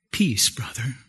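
low, hushed blessing; quiet, intimate hail to a brother; calm greeting of peace. Acted. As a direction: offer it softly, almost whispered. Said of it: "Here's one for you".

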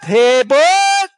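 loud, high calling out across a field; ringing herder's call; shepherd's call. Retold or imitated. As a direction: call the flock from far off. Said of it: "he sounds his voice".

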